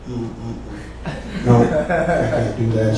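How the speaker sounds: very low, very soft mumble; acted reluctant murmur; sheepish refusal. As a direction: back out quietly under your breath. Acts his sheepish refusal to sing.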